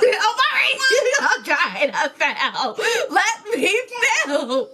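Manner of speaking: giggling at a friend; laughing tease. Real, not acted. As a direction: tease while cracking up.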